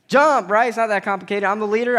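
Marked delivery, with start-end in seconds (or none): 0.1-0.7 louder, higher call of Jump; shouted command. Shown as a demonstration. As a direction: bark Jump like a game leader. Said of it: "what's everybody do?".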